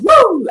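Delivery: high happy whoop; excited woo. Real, not acted. Preacher whooping to her viewers, an excited high woo. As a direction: cheer it out with joy. Excited cheer after hallelujah.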